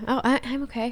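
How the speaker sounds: high, soft mock-cheerful okay; a hammed-up brave face; fake okay. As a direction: pretend you're fine in a thin high voice. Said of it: "Oh, same".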